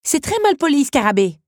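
loud, high-pitched reprimand; indignant. A narrator's reading. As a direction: scold them for bad manners. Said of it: "s'indigna Belle".

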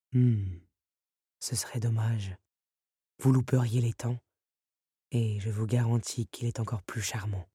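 low, softened beast voice; a hint of a teasing beast; lightly voiced tempting beast. A narrator's reading.

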